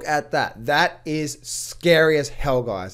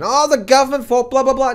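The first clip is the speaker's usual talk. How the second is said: higher, louder whiny mimic of blaming crowd; mock complainer; 7 semitones higher, 5 dB louder than usual. YouTuber mimics Chinese investors blaming the government, higher and louder. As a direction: sneer out the excuse. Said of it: "everyone blames the government".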